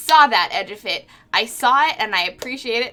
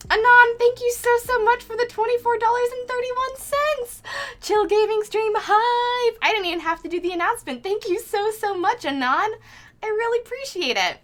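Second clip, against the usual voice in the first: high, gushing thank-you; excited thanks; 11 semitones higher than usual. Real, not acted. Streamer thanking an anonymous donor, high and gushing. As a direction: squeal your thanks to the donor.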